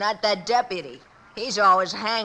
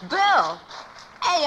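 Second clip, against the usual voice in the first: surprised realization; 7 semitones higher than usual. Acted. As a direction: catch the name, suddenly sure. Boy realizes who the stranger is.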